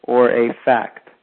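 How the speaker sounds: normal talking voice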